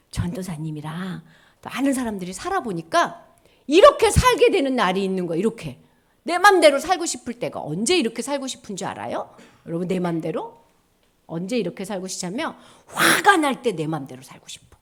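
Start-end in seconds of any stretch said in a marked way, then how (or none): none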